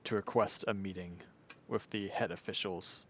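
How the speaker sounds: lightly acted, low, quiet petitioner; in-character formal request.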